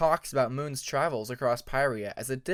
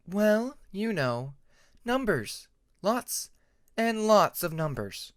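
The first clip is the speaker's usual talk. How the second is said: higher, lightly voiced young dragon; a hint of weary teasing; lightly voiced Tempest, mock-tired; 6 semitones higher, 4 dB louder, slower than usual. Tempest answers about class.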